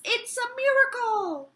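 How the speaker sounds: high, loud burst of delight; overjoyed exclamation. Acted. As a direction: exclaim the miracle at full joy. Joyful exclamation over tortoise.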